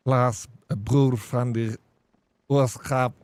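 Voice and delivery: put-on Klaas 'n broer van die Ooskaap in thick Afrikaans accent. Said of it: "Speak like that".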